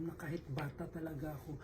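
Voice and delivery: soft, unvoiced whisper, holding back tears; hushed whisper. Real, not acted. Guest remembering his mother's death, hushed whisper. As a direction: breathe the words out barely aloud.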